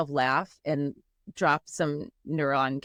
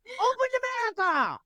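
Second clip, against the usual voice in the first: a much higher, mocking cry; mock ranting yell. Mocking TV commercials with a high ranting cry. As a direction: throw up a ranting demand in jest. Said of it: "I'm just like".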